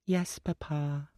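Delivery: high, slow, meek; a little girl giving in; small girl voice. A narrator's reading. Little girl answers her father, slow meek child voice.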